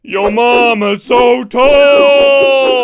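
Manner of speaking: loud, slow, drawn-out holler; booming comic setup; hollered joke setup. Acted. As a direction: stretch the last word big and loud. Joke setup called out dramatically.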